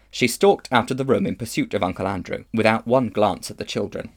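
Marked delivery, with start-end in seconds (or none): none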